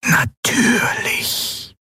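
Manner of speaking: slow, voiceless disembodied whisper; ghostly whisper. A narrator's reading. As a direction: breathe it like a spirit. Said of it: "die Flüsterstimme war die gleiche". (körperloses, langsames Flüstern)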